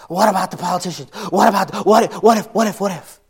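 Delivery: mock frantic worrying, rising and loud; panicky worrier. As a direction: let the worry build into near panic. Worry spiralling out loud, voiced.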